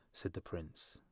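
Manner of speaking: usual speaking voice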